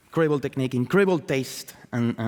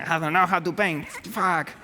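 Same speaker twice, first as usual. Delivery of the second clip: higher, whiny voice of the struggling painter; mock painter despair; 6 semitones higher than usual. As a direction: lightly act out the frustrated artist. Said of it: "you know, like".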